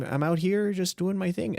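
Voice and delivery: high folksy Upper Midwest Fargo voice; Fargo accent. Shown as a demonstration. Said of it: "the Fargo voice".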